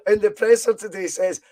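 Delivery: ordinary talk, nothing marked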